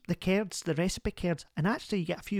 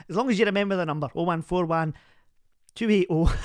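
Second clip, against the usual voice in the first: ordinary talk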